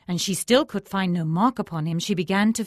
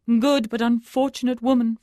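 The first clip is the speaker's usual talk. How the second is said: faltering holy man; 7 semitones higher, 9 dB louder than usual. A narrator's reading. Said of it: "faltered the learned one".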